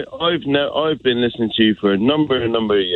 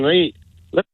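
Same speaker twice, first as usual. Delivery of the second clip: bad Scottish accent; 4 semitones higher than usual. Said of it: "the worst Scottish accent I've ever heard".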